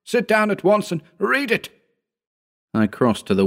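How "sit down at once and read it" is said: high, insistent order; imperious high-pitched demand; commanding. A narrator's reading. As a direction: command him urgently, pitch raised. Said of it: "he commanded".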